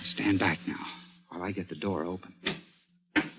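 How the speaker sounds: barely above a breath as the door opens; hushed. Acted. Opening door quietly, tense.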